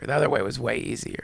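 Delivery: dropped soft, low repeat; the repetition muttered low to himself; quiet repeat. Real, not acted. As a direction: let it sink as a private admission. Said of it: "the, the other way was way easier".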